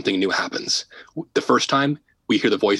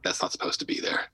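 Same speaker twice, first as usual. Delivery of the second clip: hushed whispered murmur under the breath; murmuring to herself; 3 semitones lower, 9 dB quieter than usual. Retold or imitated. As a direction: whisper it to yourself, like someone disturbed. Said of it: "murmuring to herself".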